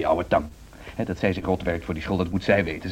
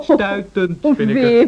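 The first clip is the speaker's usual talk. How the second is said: put-on drunken, much higher slurred disapproval; 6 dB louder, much slower than usual. Drunk husband sneering at music, pitched up. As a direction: sound tipsy and overly pleased with yourself. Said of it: "Je bent dronken".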